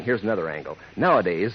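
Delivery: normal talking voice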